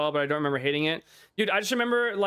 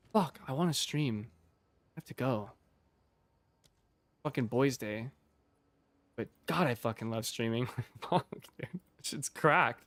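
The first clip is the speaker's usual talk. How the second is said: quiet, low; 4 semitones lower, 6 dB quieter than usual. Real, not acted. Wistful about leaving stream.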